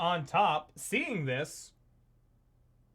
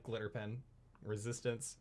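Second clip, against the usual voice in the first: quiet deadpan mumble; 8 semitones lower, 14 dB quieter than usual. Acted. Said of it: "He's just like".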